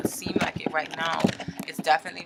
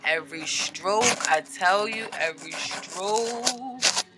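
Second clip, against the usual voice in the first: slow, drawn-out swoon, rising at the end; theatrical admiration; much slower than usual. Pretend. Tarot reader swooning over card artwork, slow theatrical admiration. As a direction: savour it, stretching the last word right out.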